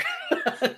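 short high laugh; amused laugh. Real, not acted. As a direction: laugh at the running joke. Laughs at running joke.

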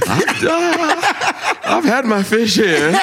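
high, laughing brag; laughing boast. Real, not acted. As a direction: brag while cracking up.

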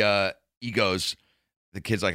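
usual speaking voice

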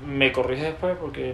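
usual speaking voice

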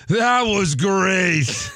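very slow, hollered announcer praise; mock JBL commentary. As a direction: stretch every word out like a blustering commentator. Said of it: "J B L says".

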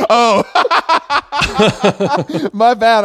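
high laughing, embarrassed; laughing apology. Real, not acted. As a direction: laugh it off, caught out. Realizes mistake, laughing.

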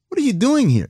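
mock disbelief. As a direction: imitate the puzzled old scout. Old scout questions intern.

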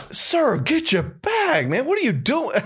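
very high, slow, drawn-out disbelief; exasperated worker. Retold or imitated. TSA worker scolding a clueless couple, very high and slow. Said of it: "this guy was like".

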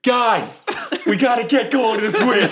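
very high call to the group; rally everyone in a high, giddy voice; excited calling out. Acted. Calling the party on to the witch, excited high cry.

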